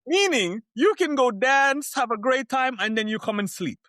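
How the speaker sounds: telling listeners about dancing in Ibiza, thrilled; excited. Real, not acted. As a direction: rave about the party night. Gushing about overnight party stops.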